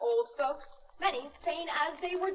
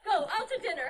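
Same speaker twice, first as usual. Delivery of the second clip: ordinary delivery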